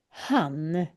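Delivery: "han" said softly with a sounded H; H sound shown. Shown as a demonstration. Teacher shows learners the Swedish H, pronunciation demo. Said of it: "på svenska kan det vara H".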